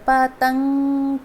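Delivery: पतंग spoken very slowly, the नं sound held apart; पतंग, very slow, nasal at the end; slow demo word. Shown as a demonstration. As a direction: draw out पतंग so the न comes after the vowel. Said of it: "त पहले आ रहा है".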